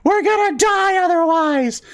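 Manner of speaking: very high, squeaky protest; hammed-up tiny cookie desperation; desperate gingerbread boy. Gingerbread boy answering his worried buddy, desperate.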